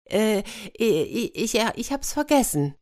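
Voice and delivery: high-pitched, stuttering excuse; flustered stammer. Acted. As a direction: stumble over the words, caught out. Princess caught out, stammers excuse. (ertappt stotternd, mit hoher Stimme)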